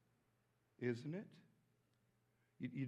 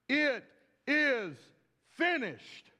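each word raised and set apart; loud cry; 9 semitones higher, 8 dB louder, much slower than usual. Retold or imitated. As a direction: cry it out word by word, higher pitched. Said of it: "he cried out in a loud".

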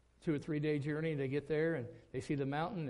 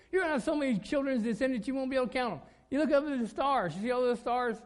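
higher, louder, fast proclamation; booming voice of God; 9 semitones higher, 6 dB louder than usual. Acted. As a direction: announce it like God promising the stars. God's promise to Abraham voiced.

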